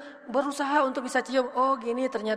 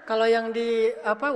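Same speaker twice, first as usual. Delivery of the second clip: ordinary delivery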